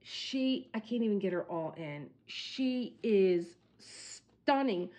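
ordinary talk

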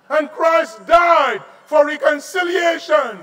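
high, loud preaching cry; fervent loud preaching. Acted. Pastor proclaiming reconciliation, loud and high. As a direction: cry it out to the church.

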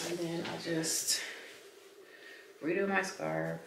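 breathless, panting between words; a little winded while talking; out of breath. Real, not acted. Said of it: "I don't know why I'm out of breath".

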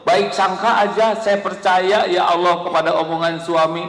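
full-force preaching. Acted. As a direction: drive the point home to the congregation.

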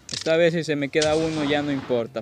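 normal talking voice

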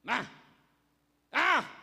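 loud drawn-out sigh of relief; mock contented sigh. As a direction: sigh like a man enjoying shade. Jonás suspira bajo la sombra. (suspiro exagerado de alivio)

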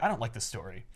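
low, soft unimpressed mutter; deadpan dad. Retold or imitated. Peter answering the teen, low deadpan. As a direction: flatly shut her down. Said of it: "And he's like".

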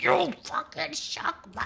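mocking angry teammate. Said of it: "Then it's like".

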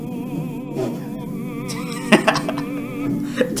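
high unvoiced laughing fit; can't stop laughing in disbelief; shocked laughter. Real, not acted. Laughs at video.

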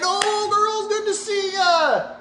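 very high, slow, sing-song gushing greeting; high-pitched mock delight at seeing the girls; mock cheery Naomi. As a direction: gush like a delighted old friend. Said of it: "Was Naomi as excited?".

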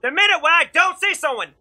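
high-pitched indignant protest; exasperated complaint. Real, not acted. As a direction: protest the unfair death, voice rising. Complaining about being killed in game.

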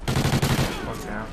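usual speaking voice

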